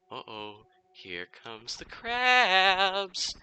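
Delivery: excited singsong announcement building to a loud, high, long-drawn CRABS; excited kid's cry. Retold or imitated. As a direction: gleefully announce it, rising into a drawn-out yell. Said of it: "I very excitedly said".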